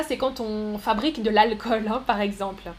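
ordinary delivery

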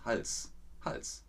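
"Hals" ending in a voiceless, hissed s; soft "Hals, Hals" with a sharp final s. Shown as a demonstration. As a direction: finish "Hals" on a crisp s. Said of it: "benutzt das scharfe S".

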